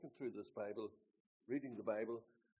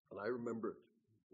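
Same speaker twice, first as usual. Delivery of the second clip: ordinary talk, nothing marked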